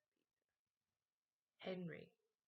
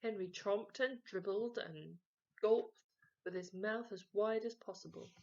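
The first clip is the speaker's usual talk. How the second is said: ordinary delivery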